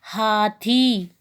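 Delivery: "हाथी" with a long falling ई; drawn-out ई. Shown as a demonstration. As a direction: stretch "थी" slowly down. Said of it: "खींचकर पढ़ी जाती है, नीचे की तरफ". ("हाथी" का आखिरी ई लंबा खींचें)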